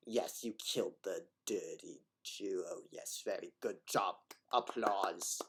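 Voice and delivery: low, quiet mock German accent; ridiculous German accent. Gamer mocking what game voices supposedly say, hammed-up German accent. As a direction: put on a silly German accent, softly. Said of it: "ridiculous German accent".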